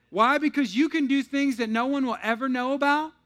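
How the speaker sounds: loud high impassioned sermon; impassioned loud preacher; full-force preaching. Acted. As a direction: preach it hard to the crowd.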